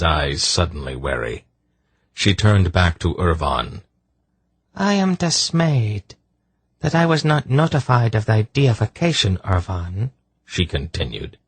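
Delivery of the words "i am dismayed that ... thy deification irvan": high voice of a haughty sorceress; Zandramas. A narrator's reading. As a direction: address the false god in a high voice. Sorceress addresses the would-be god.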